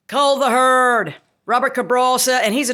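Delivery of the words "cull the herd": ordinary talk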